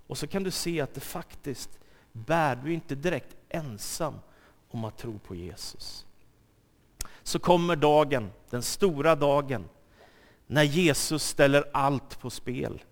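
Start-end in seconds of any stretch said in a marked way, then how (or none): none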